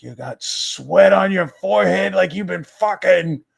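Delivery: high, loud mock yell as grandpa. Grandpa yelling at Denise coming home late, high mock yell. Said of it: "it was like".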